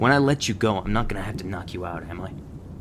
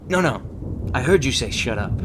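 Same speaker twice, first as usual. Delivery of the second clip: loud, raised cutting-off; angry confrontation; 4 semitones higher, 5 dB louder than usual. Acted. Trainer called out recruit saying shut up, angry. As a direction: pounce on the backtalk, higher and louder.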